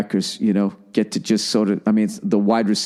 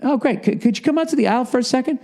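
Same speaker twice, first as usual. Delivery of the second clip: higher-pitched, hesitant politeness; polite security guard; 11 semitones higher, faster than usual. Retold or imitated. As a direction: lightly act a guard coaxing someone away. Guard politely coaxes him out.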